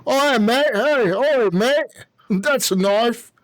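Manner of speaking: put-on Australian accent. Host teasing an Australian listener, mock Australian accent. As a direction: put on a playful Aussie movie-hero accent. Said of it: "The Australian accent".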